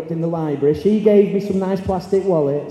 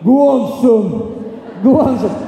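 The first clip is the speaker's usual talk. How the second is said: higher, louder laddish cheer; 4 semitones higher, 4 dB louder, much slower than usual. Retold or imitated. Lads in class urging Brett on, loud cheer. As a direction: egg him on like a crowd.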